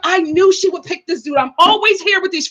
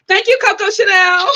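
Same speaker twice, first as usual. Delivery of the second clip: laughing thanks; 3 semitones higher than usual. Real, not acted. Thanking the super chat donor, laughing. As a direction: crack up while thanking her.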